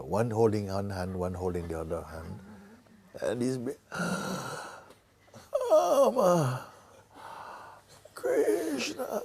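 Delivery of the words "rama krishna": a high strained cry of each name; dying cry. Shown as a demonstration. The dying father crying out high for his two sons. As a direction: cry out the sons' names with the last breath. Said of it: "like this, no?".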